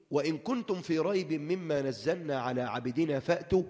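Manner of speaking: measured, slow recitation; Quran recitation. Acted. Preacher reciting the verse to the congregation, slowly. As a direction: recite with solemn care.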